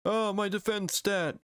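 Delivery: high, slowed cartoon whine; mock lament. Pokemon losing its defense stat, high mock whine. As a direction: mourn your lost stat in a silly voice.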